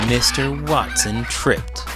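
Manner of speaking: plain reading voice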